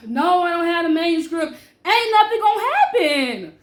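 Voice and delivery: loud, high brush-off; dismissive. Retold or imitated. Telling her fiance nothing will happen, loud and dismissive. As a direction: wave off his worry loudly.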